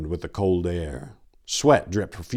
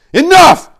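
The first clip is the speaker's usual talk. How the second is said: loud, much higher command; commanding shout; 18 dB louder than usual. Acted. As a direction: bellow the order. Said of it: "Tor commanded".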